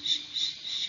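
whispered hushing ش ش, voiceless sh; sound of shin. Shown as a demonstration. Teacher shows the ش sound to kids, whispered sh. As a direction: hiss out ش ش like shushing. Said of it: "صوتي ش".

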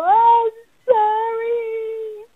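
high, slow, crying "I'm sorry"; sobbing apology. Acted. Child forced to apologize to a sibling, sobbing. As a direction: sob it out like a child caught in the wrong. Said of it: "this is what they feel like".

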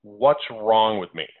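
a slow, searching question to yourself; slow self-questioning. Pretend. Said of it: "you should be asking yourself".

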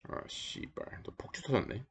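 whispered curse; cursing under breath. Real, not acted. Game goes wrong, muttered curse.

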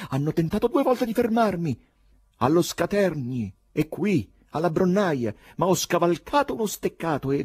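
high, loud, heated account; agitated retort. A narrator's reading. Poet telling how he escaped being stopped, agitated. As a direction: answer the crowd loudly, pitched high.

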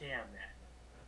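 very low, hushed curse; muttered curse. Acted. Coach hears the star signed in Russia, muttered curse. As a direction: curse under your breath.

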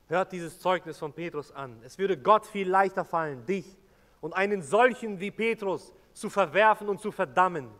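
forceful sermon delivery; preaching at full force. Real, not acted. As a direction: hammer the message home to the church. Preaching to the congregation.

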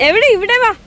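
high, loud call. Real, not acted. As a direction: call them over from far. Calling someone over.

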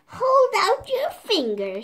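loud, high witch shout. A narrator's reading. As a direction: bark the order like a witch. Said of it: "she shouted".

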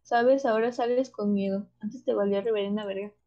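ordinary talk

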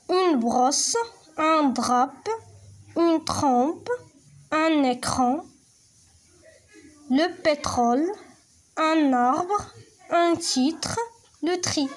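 each word read slowly and clearly; careful model reading; word reading drill. Shown as a demonstration. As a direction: model every word for the class. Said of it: "نقراو مع بعضياتنا هاد الكلمات".

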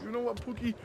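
ordinary talk

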